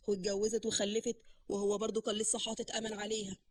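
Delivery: a quiet, mocking jibe; sarcastic. A narrator's reading. Man taunts brother about an old crush, mildly sarcastic. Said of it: "رد بسخرية وقال".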